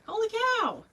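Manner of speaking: high, loud amazed gasp; stunned delight. Real, not acted. Amazed at own bow.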